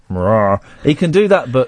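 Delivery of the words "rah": low sung "rah". Acted. Host imitating the singer's deep register, low note. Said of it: "when he goes down to deep".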